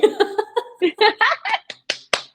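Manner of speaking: high giddy laugh. Real, not acted. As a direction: laugh with delight.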